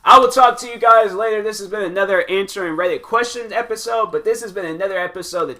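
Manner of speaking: outro sign-off. Real, not acted. Channel sign-off.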